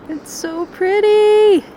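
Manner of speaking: swooning over the plants; gushing. Real, not acted. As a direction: coo over how pretty it is. Admiring wreath plants.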